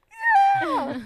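very high, loud squealed yes; piercing, hammed-up yell of acceptance; excited yes. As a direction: shriek the answer in mock overjoyed re-enactment. Re-enacts her answer to proposal.

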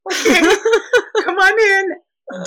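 high, slow, laughing, flustered welcome. Retold or imitated. Overwhelmed new mother welcoming the Italian neighbor, laughing. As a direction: let the guest in while laughing.

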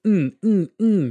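short, high, loud repeated "mm"; isolated "mm" sound. Retold or imitated. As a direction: imitate a looped snippet of "mm". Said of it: "snip out that one little sound".

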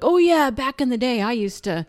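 lightly voiced kid playing the seasoned veteran; boy talking like an old-timer. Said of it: "he even talks like".

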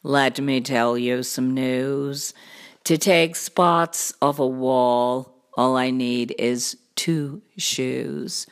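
low cartoon cat voice; deep show-off storybook cat; boastful cat. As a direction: explain it deep and unbothered.

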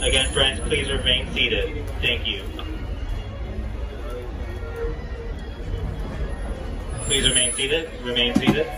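brisk PA announcement through a speaker; station announcement. Real, not acted. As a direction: announce over the station loudspeaker. Station PA tells riders to stay seated.

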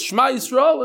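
the cantor starts the prayer; leader's call. Shown as a demonstration. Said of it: "Like he says".

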